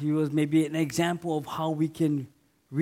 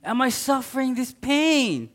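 high, louder cry of why; anguished why; 7 semitones higher, 4 dB louder, slower than usual. Retold or imitated. Preacher voicing a sufferer asking God why. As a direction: cry out the question. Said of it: "sometimes we ask God".

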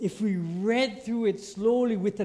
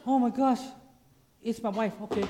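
raised start, dropping to casual; mock excitement deflating; 4 semitones higher than usual. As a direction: start thrilled, then shrug off the caller. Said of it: "It's like".

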